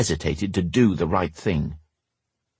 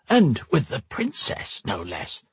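put-on exasperated old man; 5 semitones higher, slower than usual. A narrator's reading. Max exasperated.

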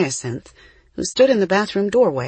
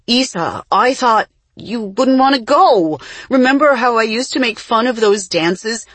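high, loud protest; pleading self-defence; 6 semitones higher, 6 dB louder than usual. A narrator's reading. Jessie explaining herself to her angry twin, pleading loudly. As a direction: protest urgently, justifying yourself.

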